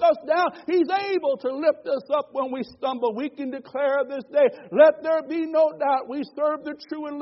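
loud, high, rhythmic sermon climax; a preacher declaring to the congregation; preaching at full force. Acted. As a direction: lift it up and declare it.

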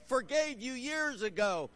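ordinary talk, nothing marked

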